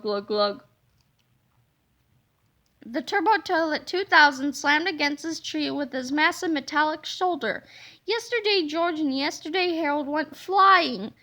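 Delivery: ordinary delivery, nothing marked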